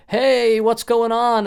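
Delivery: high, loud breezy hello; fake casual greeting. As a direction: act cheery over the panic. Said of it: "I'm like".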